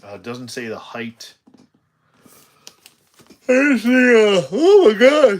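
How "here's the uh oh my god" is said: sudden high yelp of alarm; high, loud startled shriek; startled cry. Real, not acted. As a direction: jump and cry out in surprise. Sudden startled outburst mid-unboxing.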